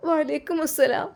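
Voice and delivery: crying through the greeting, voice lifted; tearful farewell. Acted. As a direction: say goodbye to your brother while weeping. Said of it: "ഇനി കരയണ്ട".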